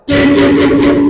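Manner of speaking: high, held-level honks; imitated car horn. Preacher mimicking traffic at a crossing back home, imitated horn. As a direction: honk repeatedly like a speeding car. Imitates horns of speeding cars.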